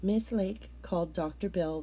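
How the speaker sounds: usual speaking voice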